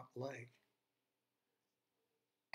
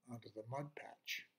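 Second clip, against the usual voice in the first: whisper; 3 semitones lower, 8 dB quieter than usual. A narrator's reading. Narration sinks to a whisper.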